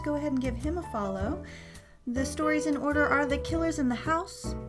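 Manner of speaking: usual speaking voice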